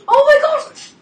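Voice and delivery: gasp, then a high, loud slow cry of shock; real startled shriek; shocked gasp and shout. Real, not acted. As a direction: jump out of your skin and yell it. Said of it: "I'm trying so hard not to scream".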